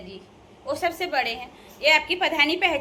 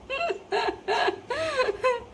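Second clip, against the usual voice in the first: laugh; 10 semitones higher than usual. Real, not acted. Laughs after praising herself.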